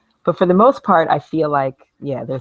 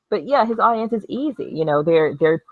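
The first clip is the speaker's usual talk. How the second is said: usual speaking voice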